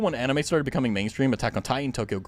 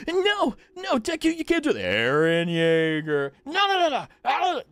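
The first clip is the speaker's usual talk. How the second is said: high squealing anime fan voice; weeaboo meme voice; 10 semitones higher than usual. Retold or imitated. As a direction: squeal it like a cringe weeb. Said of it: "the meme where the fucking guy is like".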